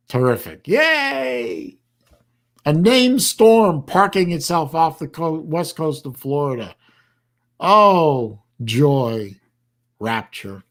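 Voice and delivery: mock celebration, sinking to a deadpan finish; sarcastic cheer. Real, not acted. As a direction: fake delight at bad news, high drawn-out yay. Sarcastic cheer at approaching storm.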